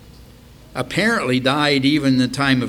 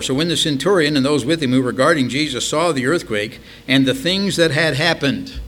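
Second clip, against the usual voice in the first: raised-pitch Bible verse, read out; scripture reading; 3 semitones higher, 4 dB louder than usual. Acted. Preacher reading of the centurion at the earthquake, declaimed. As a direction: read the verse aloud with firm projection.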